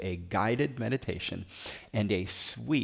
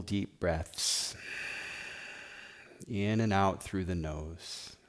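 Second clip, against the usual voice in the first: guided meditation; 2 semitones lower than usual. Acted. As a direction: guide them gently into stillness, phrase by phrase. Leads guided meditation and prayer.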